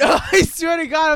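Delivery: high, loud, slow laughing shout; laughing protest. Real, not acted. Friend insists she is really intrigued, shouting through laughter.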